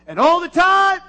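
high, loud call to the congregation. Real, not acted. Pastor prompting the congregation's response, calling out. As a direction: call it out for the church to answer.

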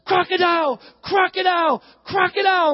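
high, loud shout of alarm; yelling child. Retold or imitated. Preacher imitates son bursting into bedroom, yelling. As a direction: yell it like a kid bursting in. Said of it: "He's yelling".

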